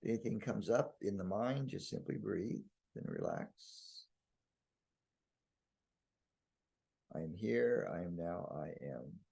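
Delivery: calm, spacious voice that settles at phrase ends; guided meditation blessing. Real, not acted. Leading listeners in blessing the brain, hushed meditation. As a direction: bestow a peaceful blessing, unhurried.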